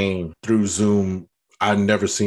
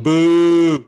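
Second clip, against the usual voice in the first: mock booing; 7 semitones higher, 7 dB louder than usual. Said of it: "I was like".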